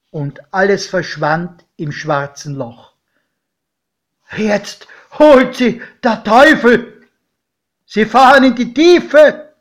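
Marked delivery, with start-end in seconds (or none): 4.3-9.5 high, loud panicked yell; terrified shout. A narrator's reading. Godfather watching the train vanish, shouting in terror. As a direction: scream as if the devil takes them. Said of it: "schrie mein Pate".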